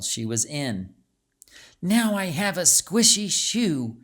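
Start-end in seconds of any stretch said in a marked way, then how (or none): 1.8-4.0 put-on higher, whiny little girl complaint; complaining. A narrator's reading. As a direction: grumble about the wet shoe. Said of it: "she complained".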